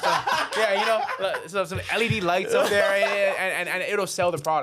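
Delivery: high, loud, laughing mockery of a clueless flipper; mocking naive flippers. Host mocks naive flippers to guest, high and laughing. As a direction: ham it up like a beginner who thinks paint sells houses. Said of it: "They, they think you just buy a property".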